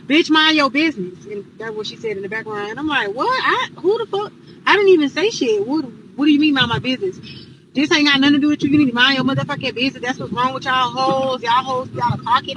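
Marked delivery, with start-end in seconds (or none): 0.1-1.2 louder, higher imitation of an angry roommate; mock a roommate's angry outburst; snappy retort. Roommate telling off the visitor from the background, angry. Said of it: "then I hear Kat like". 3.1-7.2 loud, affronted retelling; indignant disbelief. Retold or imitated. Visitor answering the roommate's insult, indignant. 7.8-12.5 loud, heated quarrel retold; angry telling-off. Roommate and visitor trading insults, heated and loud.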